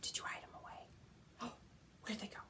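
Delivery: hushed whisper of mock surprise; playful whisper. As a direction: whisper like it's a secret game. Hiding fingers game.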